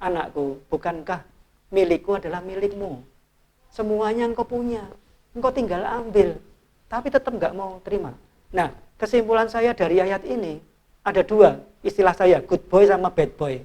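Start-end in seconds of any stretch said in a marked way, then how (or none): 0.0-8.1 patient father. Retold or imitated. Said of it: "papanya sabar nih".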